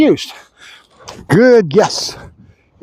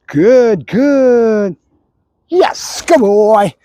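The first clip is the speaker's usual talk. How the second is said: dog praise marker; 2 semitones higher, much slower than usual. Real, not acted. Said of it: "I'm chattering so much with good".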